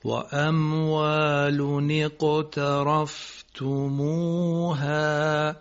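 اقترفتموها chanted very slowly, every syllable clear; correct recitation. Shown as a demonstration. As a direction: model و اموال اقترفتموها in slow chant. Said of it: "خوب نتونستی تلفظ بکنی".

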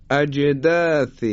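اجداث slowly, the ج bouncing with qalqala; slow recitation demo. Shown as a demonstration. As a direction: stretch اجداث with an echoing ج. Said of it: "به حرف جیم توجه بفرمایید".

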